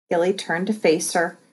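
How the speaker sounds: ordinary delivery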